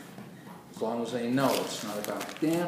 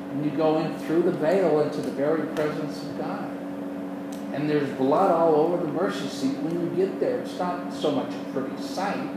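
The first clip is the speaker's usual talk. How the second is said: fervent preaching; 4 semitones higher, 5 dB louder than usual. Acted. Preaching at full force.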